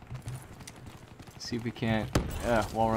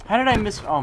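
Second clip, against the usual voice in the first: frustrated yell; 10 semitones higher, 8 dB louder than usual. Real, not acted. Misses shots, frustrated.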